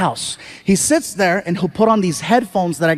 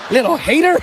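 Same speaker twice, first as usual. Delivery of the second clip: high-pitched teasing jab; mocking taunt; 6 semitones higher than usual. Father more popular than his son, mocking tease. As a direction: needle him between chuckles.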